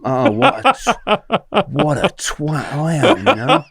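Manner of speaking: high-pitched, laughing through the words; helpless laugh at himself; laughing self-mockery. Real, not acted. Storyteller realising he confided in a triad, laughing in self-mockery. Laughs at his own foolishness.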